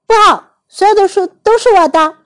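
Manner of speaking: high, put-on little boy voice; childish insistence on keeping everything; possessive child. A narrator's reading. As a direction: refuse stubbornly, pitched high. Oliver refuses to share books.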